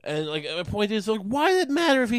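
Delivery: usual speaking voice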